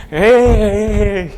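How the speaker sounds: high, drawn-out excited whoop; retold childish cheer. Boy told he is going to India, mock excited cheer. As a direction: cheer like a kid promised a trip.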